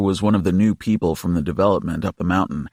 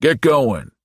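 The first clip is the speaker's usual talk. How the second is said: louder, firm dismissal; gruff order; 4 semitones higher, 4 dB louder than usual. A narrator's reading. Hellboy ordering the imp away, louder. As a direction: shoo the pest off. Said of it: "Hellboy says".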